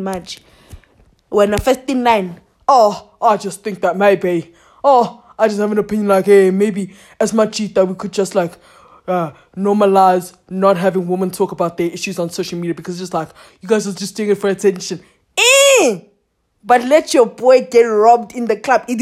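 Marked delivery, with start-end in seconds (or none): 2.7-15.0 lightly put-on man offering his opinion; a hint of a lower voice, imitating men; mimicked opinionated men. Men weighing in on women's issues, mildly mimicked. 15.4-16.0 high, loud "eh!" of protest; sharp exclamation. Acted. Mocking men's double standard, high exclaimed eh.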